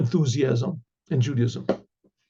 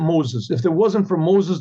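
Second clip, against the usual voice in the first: normal talking voice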